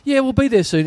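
high, bright cabin-crew promise; chirpy stewardess. Retold or imitated. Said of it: "the stewardess is like".

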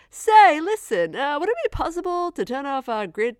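high-pitched put-on official, loud opening word; hammed-up caller, pitched well up; walkie-talkie request. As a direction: mimic a polite request over the radio. Acting walkie-talkie request in film.

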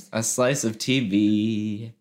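slow, drawn-out show title announcement; announcer voice. Pretend. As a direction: announce the segment name slowly.

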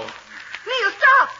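wife screaming in horror; terrified scream. Acted. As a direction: scream to stop him. Wife sees him walk to railing.

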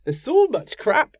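put-on Scottish accent. Podcaster retrying the Sneddon quote in a bad Scottish accent. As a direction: another clumsy go at a Scottish accent, drawn out. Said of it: "How would you even do a Scottish accent?".

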